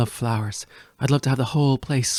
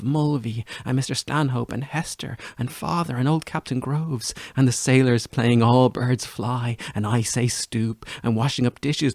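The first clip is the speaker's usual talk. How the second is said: ordinary talk, nothing marked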